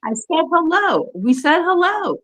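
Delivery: high, slow singsong protest; singsong insistence. Real, not acted. Insisting to friends she did greet Larnie, in a high slow singsong.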